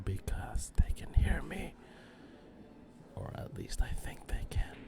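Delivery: hushed whisper so players won't hear. Real, not acted. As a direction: whisper it close to the mic. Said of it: "I have to whisper".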